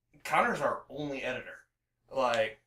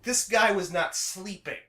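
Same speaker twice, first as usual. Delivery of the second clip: excited; 7 semitones higher, 4 dB louder than usual. Real, not acted. Excitedly telling about overwork.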